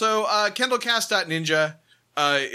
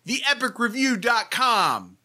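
usual speaking voice

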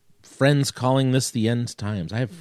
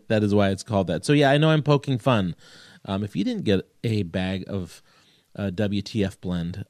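ordinary delivery

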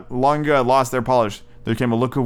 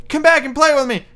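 high, loud little rabbit plea; calling; 5 dB louder than usual. A narrator's reading. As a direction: call after departing friends in a piping voice. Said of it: "called the little rabbit".

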